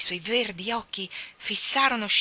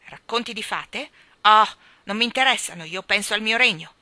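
impatient king; 3 semitones higher, 11 dB louder than usual. Acted. King dismisses fairy tales.

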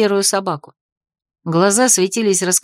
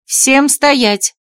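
louder, higher command to halt; restrained narrator's shout of command; booming command; 4 semitones higher, 4 dB louder than usual. A narrator's reading. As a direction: ring out an order to everyone. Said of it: "Получилось не просто громко, а оглушительно".

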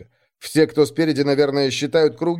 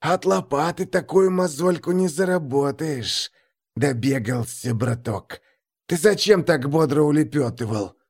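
sinister, hissing taunt; 3 semitones higher than usual. A narrator's reading. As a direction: hiss the accusation like a nasty thug. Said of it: "какое-то шипение".

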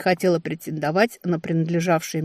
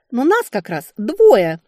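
loud, slow, raised-pitch indignation; offended huff; 5 semitones higher, 8 dB louder, slower than usual. A narrator's reading. Left-out sister protests to the narrator, offended. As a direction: huff it out, hurt. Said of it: "с обидой фыркнула Арона".

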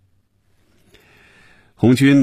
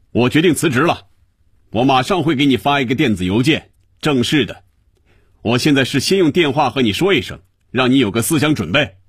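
raised, high-pitched declaration down the phone; loud outburst; 6 semitones higher than usual. A narrator's reading. Quitting employee tells the boss over the phone, mild shouting. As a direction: announce it hard, pent-up resentment coming out, short of a full shout. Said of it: "于威对着话筒大声地嚷着".

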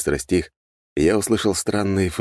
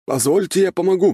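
high-pitched, louder offer of help; eager servant; 13 semitones higher, 4 dB louder than usual. A narrator's reading. Fire mage offers to help his master, eager high voice.

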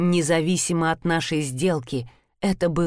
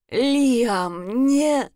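raised, strained croaking voice; hoarse croak; 5 semitones higher than usual. A narrator's reading. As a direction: force the words out through a raw throat. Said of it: "прохрипела я".

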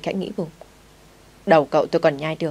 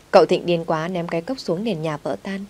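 plain reading voice

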